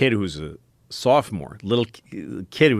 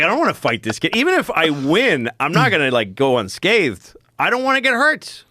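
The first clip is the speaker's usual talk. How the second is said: higher, pleading worry; scared inner voice; 8 semitones higher than usual. Retold or imitated. Scared senior thinking about fighting the kid, higher worried voice. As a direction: fret aloud like a scared teen. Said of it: "'Cause I'm like".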